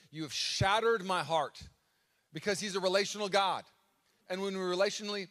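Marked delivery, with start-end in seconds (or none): 0.1-1.6 slow, emphatic and louder; shattered heart. Shown as a demonstration. Pastor stressing the Hebrew meaning, slow and emphatic. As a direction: lean into every word slowly. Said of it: "that Hebrew word".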